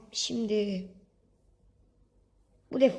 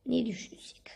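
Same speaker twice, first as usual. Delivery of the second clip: whisper; 8 dB quieter than usual. Real, not acted. Thinking aloud quietly.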